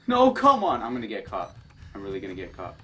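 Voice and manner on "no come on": frightened protest. Real, not acted. About to get caught.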